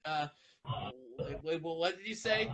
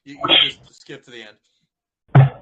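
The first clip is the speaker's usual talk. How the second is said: loud heckle; 6 semitones lower, 17 dB louder than usual. Real, not acted. Player heckles narrator.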